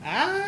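long high shriek of terror; woman-like scream. Acted. Sailor screaming while running up to the deck. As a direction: scream all the way up the stairs. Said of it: "He screams almost the entire way up".

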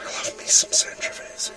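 unvoiced whisper, lost voice; raspy whisper. Real, not acted. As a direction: whisper it out, voice gone. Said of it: "when you have no voice".

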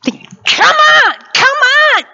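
jockey driving a horse, high and forceful; high, strained urging cry. Acted. Jockey urges a racehorse on, high driving cry. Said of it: "They don't have spurs. What do they have?".

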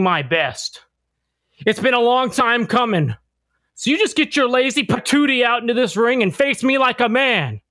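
wrestling promo. Acted. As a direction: call out your rival before the match. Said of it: "I'm gonna slip into my character".

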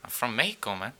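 soft, badly faked Mexican accent; unconvincing put-on Mexican accent. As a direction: play a fake Mexican guy, quietly. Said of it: "That is the worst Mexican accent".

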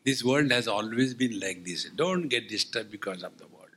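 ordinary talk, nothing marked

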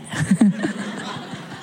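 real breathy chuckle; laugh. Real, not acted. Preacher joking about the baby, real chuckle. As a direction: chuckle at your own joke.

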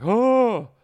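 high loud startled yelp; a sudden yelp of fright; startled cry. Retold or imitated. Retelling the skid, a loud startled yelp. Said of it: "and I was like".

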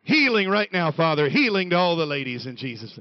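loud mock faith-healer's prayer, falling away; mock healing prayer. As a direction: pray it out loudly like an overcome church lady. Mock prayer of the swooning ladies.

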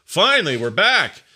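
imitating a delighted colleague returning to the office; thrilled coworker. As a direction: cheer it like you've been freed. Stoked coworkers back at office.

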